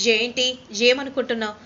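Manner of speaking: loud, sharp angry snap; angry outburst. Acted. Gagan angrily snapping at Nakshatra on the phone. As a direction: bark it at her, fed up. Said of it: "అంత కోపం వస్తుందా బావా".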